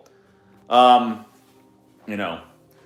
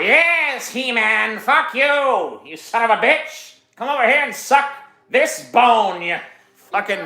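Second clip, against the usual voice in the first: high, screechy cartoon villain; hammed-up taunting villain, pitched way up; Skeletor; 10 semitones higher than usual. Skeletor cursing out He-Man, high mocking screech.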